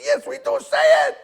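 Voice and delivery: very high, full-volume yell back; retold screaming. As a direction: act out screaming your answer at the trainer. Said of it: "screaming at each other".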